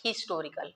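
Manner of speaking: हिस्टोरिकल said as istorical, the h dropped; silent h demo. Shown as a demonstration. Teacher showing students the h goes silent in हिस्टोरिकल. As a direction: start हिस्टोरिकल straight on the i, no h. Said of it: "हा की आवाज़ है यानी वो दब जाती है".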